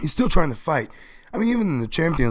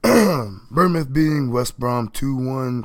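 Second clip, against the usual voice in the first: ordinary talk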